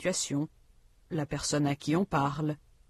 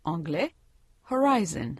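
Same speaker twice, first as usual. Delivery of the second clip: horizon said the English way, stress on the second syllable; English pronunciation. Shown as a demonstration. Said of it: "Comparez les mots français et anglais".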